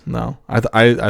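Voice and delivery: ordinary talk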